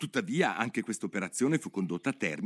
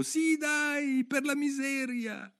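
much higher chorus of animals; the animals echo Alice, voiced much higher; echoing crowd; slower than usual. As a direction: echo her like a crowd of little creatures. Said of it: "tutti le fecero eco".

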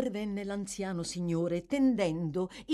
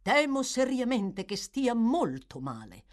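earnest, higher insistence; emphatic; 6 semitones higher, 4 dB louder than usual. Acted. Gentleman warns the magistrate the boy is ill, emphatically. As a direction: press the point energetically. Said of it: "soggiunse molto energicamente".

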